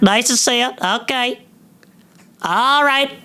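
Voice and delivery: high-pitched comic character voice; cameo guest character. Acted. Comedian as a surprise podcast guest repeating catchphrases, high character voice. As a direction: pitch it way up as a drop-in celebrity guest. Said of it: "cameo right there, and that's who it was".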